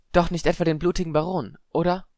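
high-pitched, concerned questioning; worried older student. A narrator's reading. Clearwater asking Padma about the ghost, worried.

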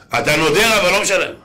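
acted reproach, voice lifted; stern rebuke. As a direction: come down hard on him as the scolding rabbi. Rabbi scolds man who defaults.

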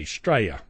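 "Australia" as two-syllable "Straya", falling; local pronunciation. Shown as a demonstration. Podcaster after spelling it out, "Australia" said "Straya". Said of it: "it's spelled S T R A Y A".

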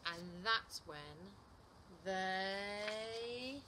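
very slow, then one long rising word; big storytime build-up; suspenseful build. A narrator's reading. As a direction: stretch "they" upward and leave it hanging. Suspense before the hug.

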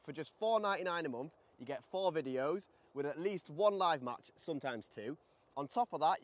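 upbeat, loud sales pitch; ad read. Acted. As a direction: sell it like an advert. Channel membership ad read.

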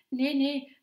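high, loud, hurried protest; quick denial. A narrator's reading. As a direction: blurt it out quickly. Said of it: "zei Daphne vlug".

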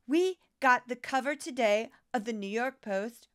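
ordinary talk

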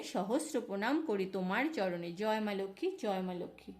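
soft, lilting recited prayer-poem; devotional verse recitation. Acted. Devotee inviting Lakshmi into her home, soft lilting recitation. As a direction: chant the invocation gently and evenly.

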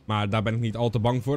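normal talking voice

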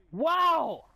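loud stunned wow; shocked, voice jumps high; surprised. Real, not acted. Stunned by enemy play.

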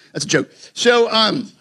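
ordinary talk

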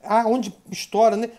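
ordinary talk, nothing marked

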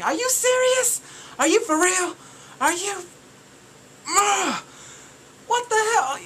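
high, loud, incredulous; high-pitched indignant outburst; outraged disbelief. Real, not acted. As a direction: shriek your disbelief. Ranting at VIP perks.

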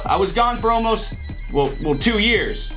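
ordinary talk

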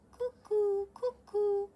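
high sing-song cuckoo; cuckoo call. Acted. Cuckoo second time.

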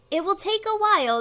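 high, loud caution; warning. Acted. Caterpillar tells the pollywog to be patient, warning. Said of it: "the caterpillar warned".

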